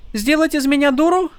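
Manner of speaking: high, teasing question; mock indignant. A narrator's reading. Tyn's mock offended question.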